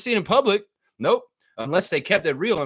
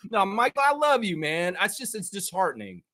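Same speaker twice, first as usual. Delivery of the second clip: ordinary delivery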